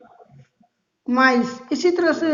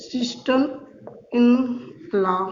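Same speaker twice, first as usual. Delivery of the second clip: sister in law slowly, word by word; word by word dictation; 2 semitones lower, 3 dB quieter, much slower than usual. Shown as a demonstration. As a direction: spell it out in separate words. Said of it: "मैंने कहा".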